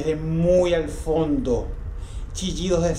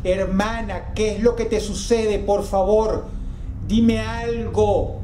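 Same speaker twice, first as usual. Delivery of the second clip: desperate plea; 6 semitones higher, slower than usual. A narrator's reading. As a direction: drag out dime algo as a desperate beg. Boy begs his screaming sister.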